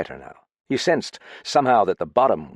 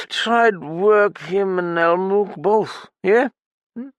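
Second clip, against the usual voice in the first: high, loud, slurred, desperate; drunk outburst; 10 semitones higher, 4 dB louder than usual. Acted. Drunk pounding the table to confess, loud and slurred. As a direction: blurt it all out.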